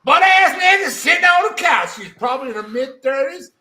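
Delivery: hammed-up Southern drawl, high and loud; a deliberately terrible Southern accent, yelling it out; bad Southern accent. Reading the story in an awful Southern accent, hammed up and loud. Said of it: "an even shittier Southern accent".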